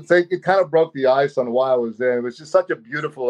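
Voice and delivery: ordinary talk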